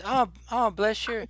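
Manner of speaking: high-pitched, doting woman; touched woman shopkeeper. Acted. Shopkeeper to the child who mended her pot, touched. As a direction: coo over the child's kindness in a lifted voice. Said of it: "And she says".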